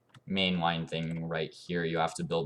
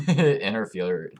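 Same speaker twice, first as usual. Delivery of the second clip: slow word through a laugh; 5 semitones higher, 9 dB louder, slower than usual. Real, not acted. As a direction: laugh while saying it. Laughing at own stumble.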